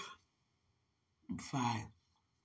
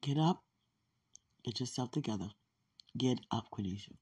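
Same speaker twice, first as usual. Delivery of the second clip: lower, calm commanding voice; voice of God; 7 semitones lower, 9 dB quieter, faster than usual. Retold or imitated. God telling Cornisha to cut the fish, lower and commanding. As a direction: speak down to her like God encouraging. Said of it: "God said".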